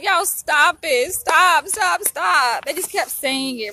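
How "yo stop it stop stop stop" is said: high, loud retold protest; mock pleading. Retelling her plea to clients, mock pleading. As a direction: beg them to stop, playing it up.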